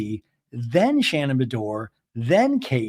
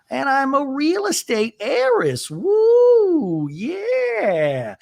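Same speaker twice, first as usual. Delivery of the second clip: high, put-on heiress voice with a squealed woo; high mock Gina, squealing woo; 12 semitones higher, much slower than usual. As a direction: brag like a giddy real estate heiress. Voicing Gina's bragging.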